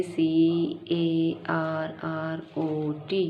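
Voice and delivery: सी, ए, आर, आर, ओ, टी spoken slowly letter by letter; letters said aloud while writing; spelling aloud. Shown as a demonstration. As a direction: spell कैरेट out slowly, one letter at a time. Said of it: "spelling बोल बोल के लिखनी है".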